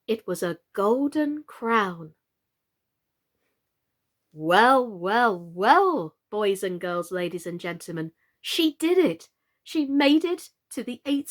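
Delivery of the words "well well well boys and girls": usual speaking voice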